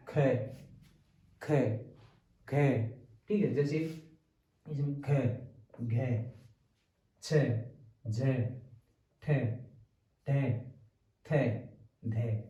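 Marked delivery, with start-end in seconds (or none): 0.1-2.5 "ख" with a strong puff of air, each one separate; ज़ोरदार महाप्राण. Shown as a demonstration. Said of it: "अधिक बल लगाना पड़ता है". 5.0-12.4 "ख घ छ झ ठ ठ ध" one by one, each aspirated; महाप्राण उच्चारण. Shown as a demonstration. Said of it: "इसमें अधिक बल लगाना पड़ता है".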